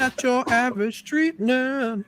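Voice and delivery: high, slow, grand slogan delivery. Pretend. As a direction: sing it out like an ad tagline. Mock slogan about nerd shirt.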